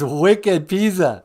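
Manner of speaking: loud, high, drawn-out Boston imitation; mock Boston accent. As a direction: ham up a slow Boston accent. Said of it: "sound like people from Boston".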